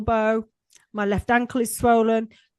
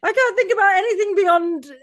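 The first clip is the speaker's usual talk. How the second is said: half crying; 8 semitones higher, 5 dB louder than usual. Real, not acted. Overwhelmed, cry heard.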